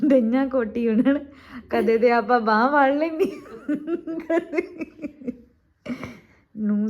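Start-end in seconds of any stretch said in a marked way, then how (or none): 0.0-5.3 talking through laughter; laughing. Real, not acted. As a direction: giggle through the words.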